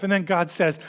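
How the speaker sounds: ordinary talk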